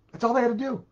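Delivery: high, loud exasperated cry; emphatic exclamation. Real, not acted. Preacher stressing the one simple rule, loud and exasperated. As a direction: throw it out in disbelief.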